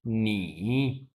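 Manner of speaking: नी said slowly with a dipping third tone; third tone demo. Shown as a demonstration. Teacher showing students नी on its own, slow dipping tone. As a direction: drag नी out low and dipping. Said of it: "नी की tone क्या होगी?".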